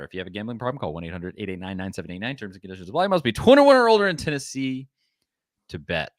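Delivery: rapid ad fine print; quick disclaimer tacked onto an ad; fast disclaimer. Real, not acted. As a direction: rattle off the legal small print. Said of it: "I try to read it as fast as I can".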